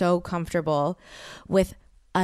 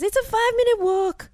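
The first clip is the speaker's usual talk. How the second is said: much higher mock cabbie voice; mocking cab driver; 3 dB louder than usual. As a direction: imitate the smug driver. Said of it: "He says".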